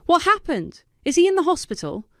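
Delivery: high, fast, frightened questions; panicked big sister; alarmed. A narrator's reading. Sister hears brother is dying.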